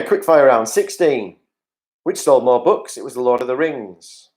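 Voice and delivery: ordinary talk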